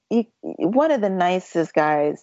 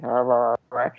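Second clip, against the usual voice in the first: low, wordless mumble; mock runner's mumble to the pacer; mumbling; 6 semitones lower than usual. Shown as a demonstration. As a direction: grumble it like you don't want to talk. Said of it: "I would start mumbling".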